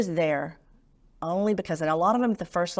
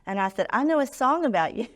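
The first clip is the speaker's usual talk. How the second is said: bright, high, louder hello; playful higher sing-song greeting; cheery greeting; 6 semitones higher, 4 dB louder than usual. Retold or imitated. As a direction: greet him with a big cheerful lift.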